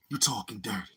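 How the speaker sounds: breathy whispered line; whispering. Real, not acted. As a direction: whisper it to yourself. Whispered repetition.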